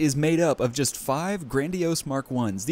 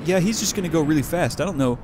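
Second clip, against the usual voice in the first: ordinary delivery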